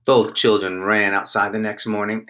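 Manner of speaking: usual speaking voice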